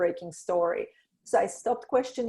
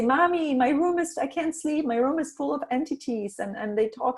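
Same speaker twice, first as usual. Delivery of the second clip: put-on child voice; 6 semitones higher, 5 dB louder than usual. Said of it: "she would say".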